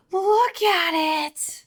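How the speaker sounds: high, drawn-out marvelling cry; gasp, then stretch it out high in wonder; awed excitement. Real, not acted. First sight of her husband's book.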